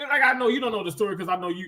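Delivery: ordinary delivery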